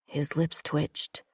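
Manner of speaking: plain reading voice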